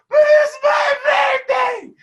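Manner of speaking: very high, loud, slow wail; hammed-up drawn-out shriek; inner wail. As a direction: scream it out like a tantrum. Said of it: "on the inside, I'm like".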